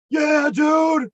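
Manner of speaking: shouted up high, wired and loud; loud friendly yell. Retold or imitated. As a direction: hail him like an over-excited buddy. Wired friend greets him loudly.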